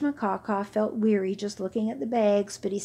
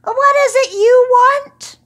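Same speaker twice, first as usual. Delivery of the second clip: high, loud call; 14 dB louder than usual. Acted. Camel calling out to mountain goats, loud and high. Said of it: "Hashmakaka called out".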